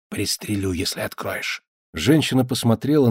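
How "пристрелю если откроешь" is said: low hushed threat; menacing whisper. A narrator's reading. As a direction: hiss the threat quietly. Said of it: "прошептал".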